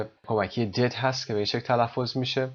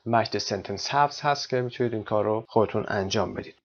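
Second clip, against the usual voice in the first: normal talking voice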